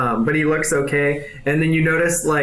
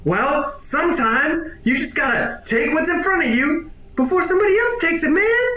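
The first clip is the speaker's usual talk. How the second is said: higher and higher, swaggering; cocky cyborg; 12 semitones higher than usual. Acted. Cyborg boasting to Wedge, pitch climbing higher.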